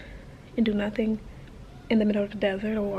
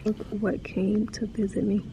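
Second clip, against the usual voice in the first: normal talking voice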